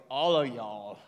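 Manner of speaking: loud, drawled Southern plural. Shown as a demonstration. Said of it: "plural in the South, they go, like".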